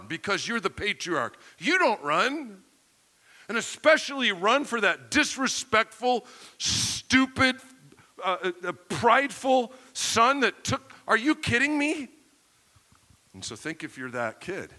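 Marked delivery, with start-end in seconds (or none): 3.5-12.1 high, emphatic scorn for the disgraceful son; indignant disbelief. Acted. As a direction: build to an incredulous, scandalized outburst. Preacher voices indignation at the son.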